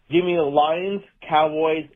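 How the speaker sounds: ordinary talk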